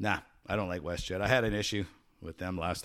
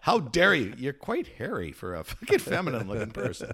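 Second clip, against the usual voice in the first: higher, louder mock indignation; mock outrage; 8 semitones higher, 9 dB louder than usual. Mock passenger scolding the airline crew, high indignant mock. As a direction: ham up being offended.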